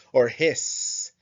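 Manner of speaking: "hiss" said with a long stretched hissing s; hissed word. Shown as a demonstration. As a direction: let the s of "hiss" hiss like a snake. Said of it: "sounds like the hiss of a snake".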